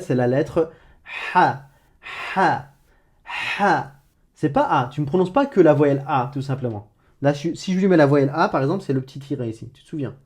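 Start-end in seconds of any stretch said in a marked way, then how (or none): 1.3-3.9 "ha" as a raspy breath through a tight throat; Arabic ḥa sound. Shown as a demonstration. Teacher repeating the letter "ha" with a scraped throat. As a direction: strangle the breath on each "ha". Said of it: "Je la répète".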